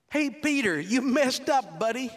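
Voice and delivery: high, loud mocking shout; loud teasing hey buddy; taunting call. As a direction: jeer at him across the water. Crowd taunting Peter.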